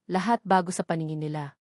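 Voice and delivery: usual speaking voice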